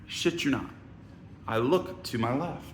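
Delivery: usual speaking voice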